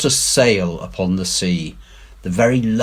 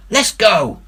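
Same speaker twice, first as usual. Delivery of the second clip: scared pirate; 13 semitones higher, 5 dB louder than usual. A narrator's reading. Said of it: "gasped one".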